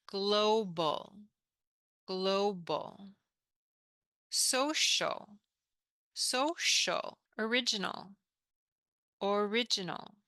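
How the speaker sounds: careful English teacher modelling; model pronunciation. Shown as a demonstration. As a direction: clear repeat-after-me words. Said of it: "we pronounce this".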